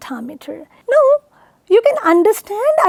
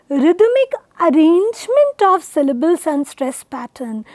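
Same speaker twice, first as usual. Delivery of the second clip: normal talking voice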